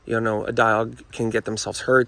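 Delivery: usual speaking voice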